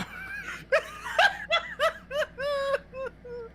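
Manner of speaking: long, high cackle; high-pitched laughter, dying away; real laughing fit. Real, not acted. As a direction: crack up helplessly. Cracking up at the impression.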